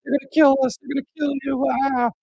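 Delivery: high panicked caged-victim shrieks; caged victim screaming. Retold or imitated. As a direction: scream like you're trapped in a cage.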